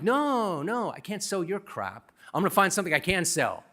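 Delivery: mock indignant comeback; high-pitched retold defiance; defiant retort. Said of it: "I'm like".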